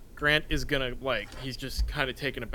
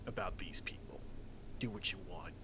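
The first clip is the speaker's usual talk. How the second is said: hushed aside, breath only; low whispered permission to a partner in crime; conspiratorial whisper; 5 semitones lower, 13 dB quieter than usual. Acted. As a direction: whisper it like a secret order. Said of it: "I gotta lean into character here".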